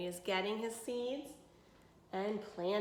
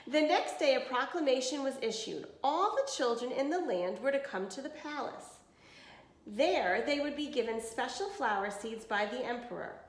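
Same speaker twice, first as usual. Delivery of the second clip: clear, even storybook reading; reading to children; 5 semitones higher than usual. Acted. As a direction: read it to a class of kids. Reads picture book to class.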